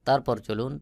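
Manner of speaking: usual speaking voice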